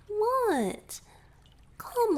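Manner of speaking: high, loud mock-incredulous what; teasing surprise. Merman teasing his shy rescuer with mock surprise. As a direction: pretend to be shocked.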